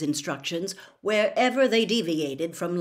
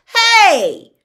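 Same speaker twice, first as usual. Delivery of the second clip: loud indignant shout, much higher; sharp indignant cry; 14 semitones higher, 17 dB louder than usual. A narrator's reading. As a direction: yell in protest as she grabs it. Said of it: "said Harry indignantly".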